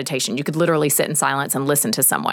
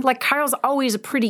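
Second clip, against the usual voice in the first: ordinary talk, nothing marked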